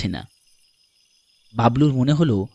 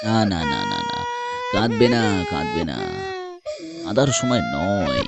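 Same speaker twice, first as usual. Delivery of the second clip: soothing; 2 semitones lower than usual. Acted. Stranger soothes crying boy. (নিচু গলায় সান্ত্বনা)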